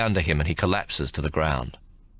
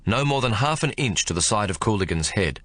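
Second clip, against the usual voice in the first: ordinary delivery, nothing marked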